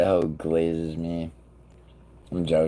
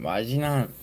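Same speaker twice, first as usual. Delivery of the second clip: normal talking voice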